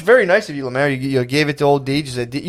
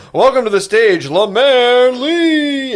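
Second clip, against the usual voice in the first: high, stretched-out stage announcement; mock announcer; 8 semitones higher, much slower than usual. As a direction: drag out the name like a show host. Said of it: "So they were like".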